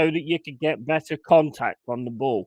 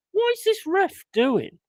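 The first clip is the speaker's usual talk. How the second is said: high, slow, outraged question; baffled by the ref; indignant; exasperated; 14 semitones higher than usual. Real, not acted. Fan outraged at referee.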